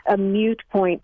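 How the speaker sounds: "mute point" said myoot point; "mute point", first word like the word for silent; incorrect variant. Shown as a demonstration. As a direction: put a y-glide after the m in "mute point". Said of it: "but that is incorrect".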